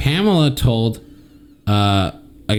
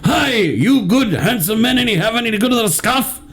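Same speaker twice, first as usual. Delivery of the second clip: high, put-on Scottish accent, flirty; 10 semitones higher than usual. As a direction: sweet-talk the men in a silly Scottish accent. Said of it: "you can only do a Scottish accent".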